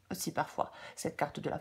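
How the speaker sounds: sniffly with a cold; sniffling. Real, not acted. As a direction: talk through a stuffy sniffle. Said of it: "je tousse et je renifle".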